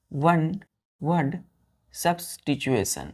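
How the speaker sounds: slow, drawn-out dictation for writing. Shown as a demonstration. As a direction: dictate each word slowly for the class. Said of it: "teacher dictating slowly". (धीमे-धीमे बोलकर लिखवाना)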